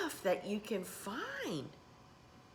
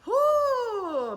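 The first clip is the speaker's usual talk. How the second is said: a loud, high whoop of delight; excited whoop; 12 semitones higher, 8 dB louder than usual. Real, not acted. Moved by her mother's devotion, a loud high whoop. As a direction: let out a big high whoo. Overcome talking about mom.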